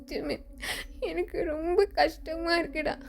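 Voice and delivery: high, sobbing lament; tearful, aggrieved high voice; crying. Acted. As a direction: pour out the hurt, voice catching. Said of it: "அழுதுட்டே நிக்கிறான்".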